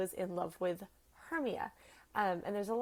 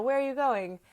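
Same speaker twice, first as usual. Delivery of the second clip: lightly raised, higher calling voice; calling her back; 5 semitones higher, 5 dB louder than usual. Acted. Hermia to Helena as she leaves, calling her back. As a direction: call after a departing friend. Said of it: "Hermia calls her back".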